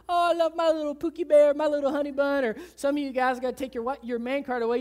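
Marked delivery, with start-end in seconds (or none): 0.1-2.4 high, louder mock-gushing spouse; gushing pet names. Preacher mocking spouses' Facebook pet names, gushing. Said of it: "you use these little pet names".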